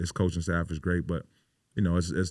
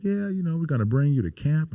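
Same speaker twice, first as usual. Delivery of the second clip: breezy, high GM impression; mock the casual manager; GM's voice, mildly mocked; 8 semitones higher than usual. GM tells the player they'll bring him to camp, breezy. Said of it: "the G M was like".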